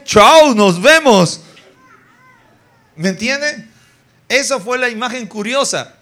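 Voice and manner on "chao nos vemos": loud, comic hasty goodbye; mock panicked farewell. Moses to the congregation's amusement, fleeing the serpent, loud mock panic. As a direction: blurt out a loud goodbye and flee.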